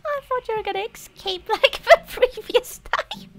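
high, giggling through the apology; laughing apology. Real, not acted. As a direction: apologize while laughing helplessly. Laughing apology after squishing friend.

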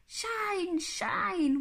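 high, stretched, falling chant; shining sun. Acted. Sun peeps into the car, high chanted shine. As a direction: beam each word out high. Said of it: "went the sun".

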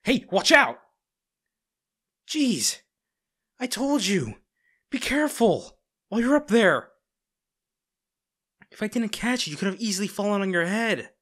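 loud and high, scolding in alarm; alarmed shout. Acted. Bodyguard shouting at the girl who nearly fell, alarmed scolding. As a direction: yell it as she slips from the ladder.

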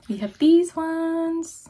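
high, slow, stretched singsong; drawn-out singsong. Pretend. Showing stickers to viewers, slow high singsong. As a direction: sing the line out playfully.

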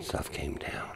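retold line in a whisper. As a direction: breathe it out, hushed, like in a spooky mine. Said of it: "And he says".

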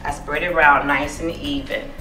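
ordinary talk, nothing marked

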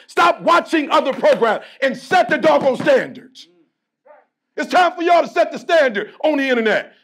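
loud, fired-up sermon, pushing every line; rally the team like a preacher; preaching at full force. Acted.

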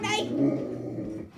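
real fright, spoken low and slow. Real, not acted. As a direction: stretch "scared" out low and slow. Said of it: "sorry if I yelled".

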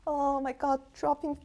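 very soft, pretend weeping; mock tearful. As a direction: act moved to tears, hushed and halting. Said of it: "why am I crying".

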